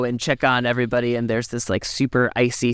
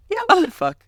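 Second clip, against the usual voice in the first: hammed-up disbelief; mock incredulous. Mocking Sidney's sudden line.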